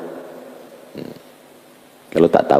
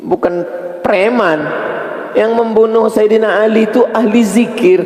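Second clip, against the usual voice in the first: preaching at full force; 14 semitones higher than usual. Acted. Preacher revealing the killer was a pious man, outraged preaching. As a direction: thunder the shocking truth.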